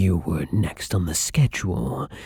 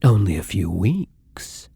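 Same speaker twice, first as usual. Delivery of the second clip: normal talking voice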